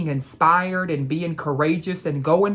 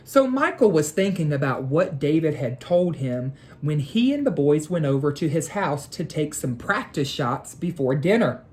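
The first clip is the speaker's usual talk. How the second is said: plain reading voice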